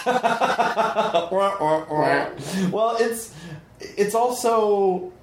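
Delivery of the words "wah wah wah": a high, mock-mournful "wah wah wah"; sad trombone noise. After the joke flops, a mocking sad trombone sound. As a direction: play the failed-joke horn.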